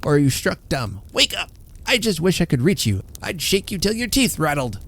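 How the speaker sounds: high, harsh scolding yell of an invalid husband; screaming in anger. A narrator's reading. Bedridden husband berating his wife, screaming. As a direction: bark it at her like a bedridden tyrant. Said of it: "he screamed".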